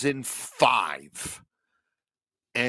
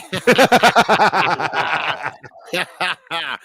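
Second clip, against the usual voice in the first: bursting out laughing; big loud cackle; 5 semitones higher, 8 dB louder than usual. Real, not acted. Big laugh at chat joke.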